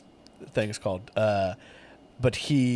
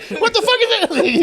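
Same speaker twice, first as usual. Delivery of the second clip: much higher incredulous squeal with laughter; mock disbelief; 3 dB louder than usual. Customer insulted at Dick's Last Resort, mock disbelief. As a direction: act out an outraged, baffled customer. Baffled customer who can't believe it.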